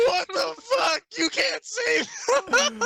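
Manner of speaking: high, breaking laugh-shout. Real, not acted. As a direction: shriek through laughter. Shocked at friend's offensive joke.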